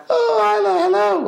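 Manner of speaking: high sing-song reindeer voice; chirpy put-on Rudolph greeting; Rudolph's voice. Dad imitating Rudolph greeting his daughter Isla. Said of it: "not a massive fan of the voice".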